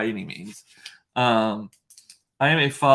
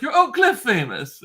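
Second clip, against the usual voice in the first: high, loud mock-Italian exclamation; put-on Italian accent, pitched well up; Daniele, Italian accent; 10 semitones higher, 5 dB louder than usual. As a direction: imitate the Italian owner's delighted proclamation. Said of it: "He was like".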